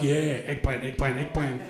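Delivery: low, soft, sleazy chant; low creepy drone. Retold or imitated. Chat partner repeating emojis, low sleazy murmur. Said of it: "He's like".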